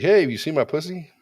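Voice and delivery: high, loud shout across a yard; mock calling out. Dad hails his neighbor about the lost cat, high loud call.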